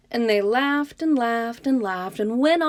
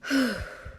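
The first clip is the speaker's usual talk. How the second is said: deep sigh; 3 semitones higher than usual. Acted. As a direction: let the breath out slowly. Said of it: "took a deep breath".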